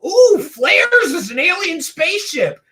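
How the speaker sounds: ooh and aah like a hammed-up UFO hype man; mocking gasps of wonder, pushed high; mock amazement. Mimics gullible UFO video hype.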